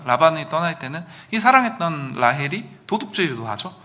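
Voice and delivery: ordinary talk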